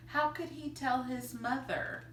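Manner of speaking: ordinary delivery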